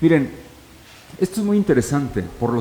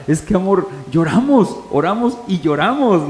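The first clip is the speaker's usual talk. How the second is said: a hint of the wife, pitched higher; lightly voiced wife; 4 semitones higher, 3 dB louder than usual. Preacher quotes his wife explaining prayer to him, lightly voiced wife. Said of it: "Dice mi esposa".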